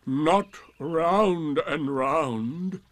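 slow, loud donkey at ordinary pitch; drawn-out, heavy correction; Eeyore correcting. A narrator's reading. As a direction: put them down slowly and firmly. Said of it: "said Eeyore".

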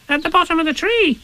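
tiny, very high squeaky youngster; high-pitched eager little voice; Roo's voice. Acted. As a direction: squeak the question like a curious little one. Roo answers Tigger.

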